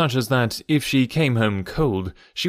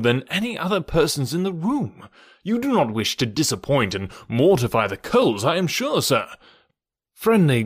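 high, lively, swooping remonstrance; animated Mister Weston; 4 semitones higher than usual. Acted. Mister Weston urging concern for the Coles, animated and protesting. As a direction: plead earnestly with big leaps of pitch.

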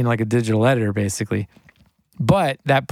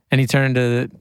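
normal talking voice

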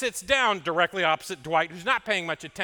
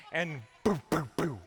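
ordinary talk, nothing marked

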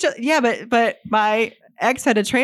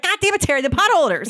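irritated dad; 7 semitones higher than usual. Retold or imitated. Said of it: "he's like".

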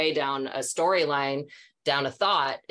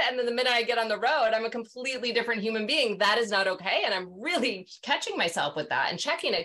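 usual speaking voice

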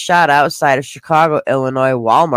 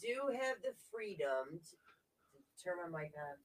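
muffled mic, far softer; 19 dB quieter, slower than usual. Real, not acted. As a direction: voice dulled by a bad microphone. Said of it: "It sounds like it's muffled".

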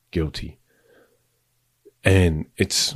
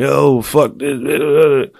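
slow, loud, much higher growling yell; 9 semitones higher, 7 dB louder, much slower than usual. Retold or imitated. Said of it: "I used to do a D M X thing very well".

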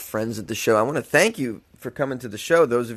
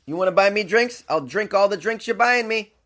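reckless party shout; 8 semitones higher, 4 dB louder than usual. Retold or imitated. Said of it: "last night I said".

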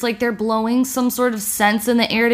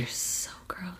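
hushed disgusted whisper; 4 semitones lower, 18 dB quieter than usual. Real, not acted. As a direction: breathe it out unvoiced.

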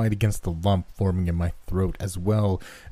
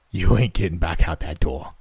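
put-on low, sneering old hag; 3 semitones lower than usual. A narrator's reading. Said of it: "she sneered".

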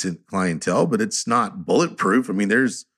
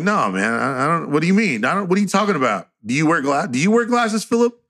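baffled reply; 6 semitones higher than usual. Retold or imitated. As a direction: pitch climbing into a high, incredulous question. Acting out his baffled reply.

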